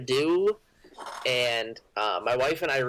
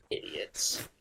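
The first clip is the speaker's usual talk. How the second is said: muttered insult; 7 dB quieter than usual. Acted.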